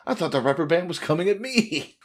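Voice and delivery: high-pitched mock alarm; mock panic. Podcaster acting out a flinching Hachi, high mock panic. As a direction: blurt it out as a frightened cartoon character.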